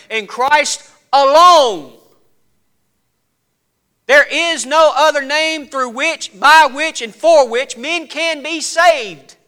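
high, forceful pulpit proclamation; impassioned preaching. Real, not acted. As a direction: proclaim it, stretching the key words.

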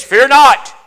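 loud booming announcement; big heralding shout; angel's proclamation. Acted. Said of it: "the first thing this angel said is".

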